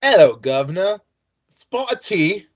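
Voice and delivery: a light posh English "spot of tea"; lightly put-on English accent; mild English impression. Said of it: "Let's see if I can still do this".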